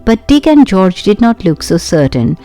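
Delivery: usual speaking voice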